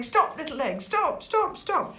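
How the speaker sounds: urgent high calls after a runaway; storybook yell. A narrator's reading. Sid chasing the bouncing egg again, high mock yell.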